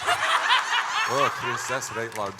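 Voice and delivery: put-on hammy Scottish accent, high and loud; a put-on Scottish brogue, laughing through it. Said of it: "Can you maybe just do your Scottish accent".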